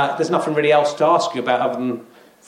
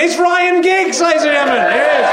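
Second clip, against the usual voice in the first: grand announcer intro; 10 semitones higher than usual. Acted. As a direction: bellow the guest's name like a game-show host. Host introduces star guest.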